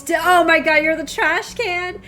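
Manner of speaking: excited squeal. Real, not acted. Player discovering the cute trash can, delighted outburst. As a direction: blurt it out loud, stretching the end.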